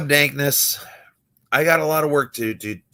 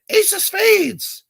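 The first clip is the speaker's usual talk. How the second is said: high, slow, drawn-out call of the card; a mock audience member calling out high and slow; heckler calling out; slower than usual. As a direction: stretch it out in a high sing-song like a smug heckler. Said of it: "somebody said".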